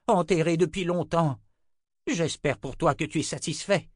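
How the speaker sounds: high, loud bitter reproach; seething anger. Acted. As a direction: scold him, boiling over. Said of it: "je bous de colère".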